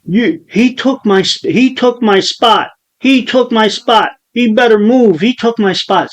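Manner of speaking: high-pitched, insistent protest; imitated upset complaint. Retelling the kid telling people around him, high and indignant. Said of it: "now he's telling people around me".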